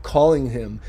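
ordinary delivery

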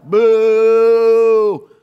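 loud, jeering boo; mock boo. Pastor voicing offended religious leaders, loud mock boo. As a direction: jeer at the parade. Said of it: "they're like".

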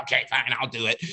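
acted resigned agreement; reluctant giving in. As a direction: give in as if worn down by nagging. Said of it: "And finally, you're like".

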